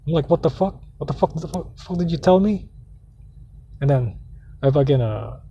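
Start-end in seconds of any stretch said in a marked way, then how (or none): 0.3-2.7 retold cussing. Nephew answering his scolding aunt, retold indignant cussing. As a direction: fire back in mild, higher indignation. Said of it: "I cussed her out".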